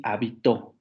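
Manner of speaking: «habitó» con el golpe en TÓ; acento en la última sílaba. Shown as a demonstration. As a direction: marca la palabra como aguda: ha-bi-TÓ. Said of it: "solamente cambian por el acento". («habitó», acentuando el final)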